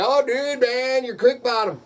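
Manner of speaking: high excited buddy voice. Retold or imitated. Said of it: "I had people say".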